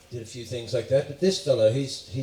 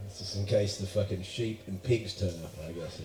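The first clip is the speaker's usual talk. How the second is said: usual speaking voice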